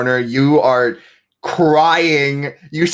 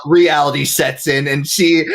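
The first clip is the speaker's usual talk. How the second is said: ordinary delivery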